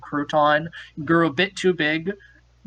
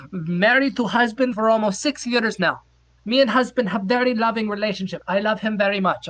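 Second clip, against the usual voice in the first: lightly put-on Norwegian accent, a bit higher; mock Norwegian accent; 4 semitones higher than usual. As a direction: play the Norwegian stranger in broken English. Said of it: "this accent is".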